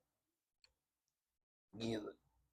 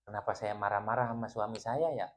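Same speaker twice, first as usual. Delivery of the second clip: puzzled wife; 3 semitones lower, 7 dB quieter than usual. Acted. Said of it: "dia itu nggak mengerti".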